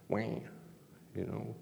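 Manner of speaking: slow, soft fake crying wah; a drawn-out babyish wah; mocking a whiny complainer; mock whine. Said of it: "pity party".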